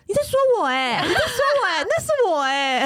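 put-on high, delighted child voice; little boy's voice. Aunt imitating her nephew's high, delighted little-boy squeal. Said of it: "一直说".

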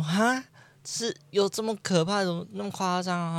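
can't believe what she hears; shocked disbelief. Retold or imitated. Friend says she might die.

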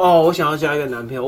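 high, loud mock declaration; half-hearted claim. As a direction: announce it without meaning it. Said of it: "表面上说：".

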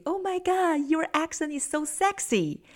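high-pitched mock swooning gush; giggly American girls. American girls admiring a British man's accent, high giggly gushing. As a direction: gush like a smitten American girl. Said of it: "all the American girls were giggled and looked at him say".

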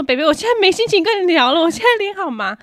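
high, loud exasperated whine; mock distressed complaint; upset loud complaint. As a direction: brush off the old man in distress. Telling old man off.

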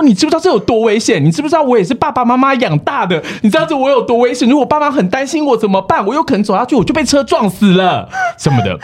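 high, plaintive, near-crying complaint; mocking whiny complaint. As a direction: parody his self-pitying blog post. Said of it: "在大骂我". (高声学他哭诉抱怨)

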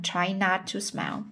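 ordinary delivery, nothing marked

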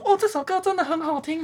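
high, sweet gushing teacher. Retold or imitated. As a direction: imitate a teacher gushing over a song. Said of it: "老师就是上课的时候一直跟你说".

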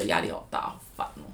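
breathy tired sigh of words; weary whisper. Real, not acted. Complaining about being tired.

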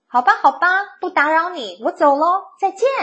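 high, loud, bouncy farewell; cheerful goodbye. A narrator's reading. Tumbleweed leaving the cactus alone, cheerful. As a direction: pipe up brightly and call goodbye.

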